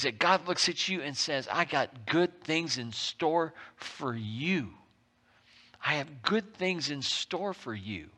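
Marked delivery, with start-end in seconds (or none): 1.5-8.1 gentle reassurance. Retold or imitated. God speaks to the listener.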